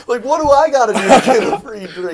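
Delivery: loud, high-pitched comic begging; playfully shouted complaint; mock plea. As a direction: cry out in exaggerated envy. Acted plea for free drink.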